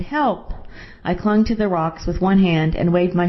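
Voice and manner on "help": restrained, falling cry for help; understated shout for help. A narrator's reading. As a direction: read a shouted plea at narrator's volume. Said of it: "I shouted".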